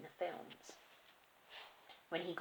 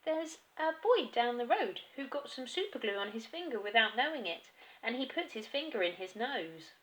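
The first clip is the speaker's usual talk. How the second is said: put-on high, loud little girl; child voice; 6 semitones higher, 5 dB louder than usual. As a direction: tell the tale sweetly. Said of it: "Matilda said".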